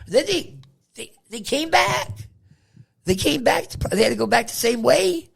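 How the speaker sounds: high-pitched disbelief; amazed. Real, not acted. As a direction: marvel at the madness. Amazed at return trip.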